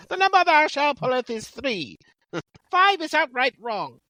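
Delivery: put-on very high Monty Python accent; put-on comic accent. Co-host asked for an accent, very high comic accent. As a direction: recite it in a silly, high-pitched accent. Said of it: "read it in an accent".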